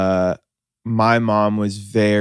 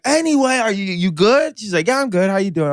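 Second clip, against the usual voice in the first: sudden breezy mom; 9 semitones higher than usual. Retold or imitated. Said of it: "within four seconds".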